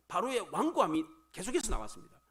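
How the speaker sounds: ordinary talk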